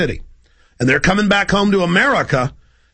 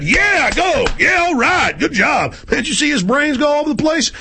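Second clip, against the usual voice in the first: much higher, whooping thug soldiers; mock cheering soldiers; 10 semitones higher than usual. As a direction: cheer the kill like rowdy troops.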